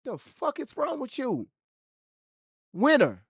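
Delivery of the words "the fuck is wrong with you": high, slow, disbelieving scold; exasperated. Real, not acted. Streamer scolding a chatter, high and disbelieving. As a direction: drag out the disbelief at the chat.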